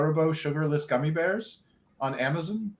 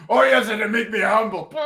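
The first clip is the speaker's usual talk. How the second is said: louder, mock thick Atlas accent; Tony Atlas impression; 3 semitones higher, 7 dB louder than usual. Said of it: "with his thick accent".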